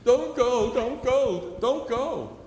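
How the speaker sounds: high, loud mock wail of a little girl; wailing toddler. Preacher imitating a toddler begging Mommy and Daddy to stay, high loud mock wail. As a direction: plead like a toddler begging her parents to stay. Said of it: "she starts wailing".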